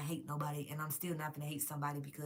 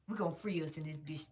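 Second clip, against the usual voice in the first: ordinary talk, nothing marked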